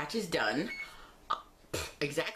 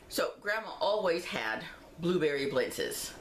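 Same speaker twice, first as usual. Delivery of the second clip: ordinary talk, nothing marked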